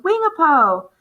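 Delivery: put-on English accent. A narrator's reading. English boy calls a greeting across the field, English accent. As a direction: call out Wingapo the way an English boy would. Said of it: "spoken with an English accent".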